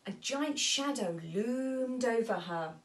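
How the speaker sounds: usual speaking voice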